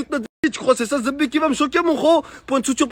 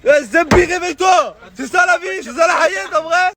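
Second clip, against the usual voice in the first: shouting; 6 semitones higher, 3 dB louder than usual. Real, not acted. Yells at friend to wake up.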